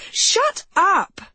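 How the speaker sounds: sudden high-pitched outburst; high, exasperated yell; irritated shout. A narrator's reading. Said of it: "Katie shouted, louder than she had meant to".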